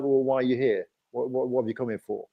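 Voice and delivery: ordinary talk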